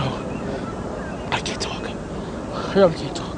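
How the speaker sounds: breathy whisper, barely holding together; choked whisper. Real, not acted. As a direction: whisper it, too shaken to talk. Shaken witness overwhelmed after blast.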